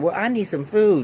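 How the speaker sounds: a hint of a high, bossy mother; lightly voiced demanding mama. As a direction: demand food like the pushy mom.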